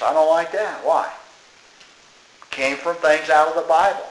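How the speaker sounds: ordinary talk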